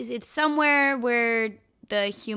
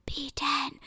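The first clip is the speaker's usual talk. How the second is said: stage whisper; 6 semitones higher, 4 dB quieter than usual. Retold or imitated. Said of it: "this loud stage whisper".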